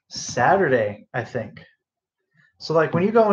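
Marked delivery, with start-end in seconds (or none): none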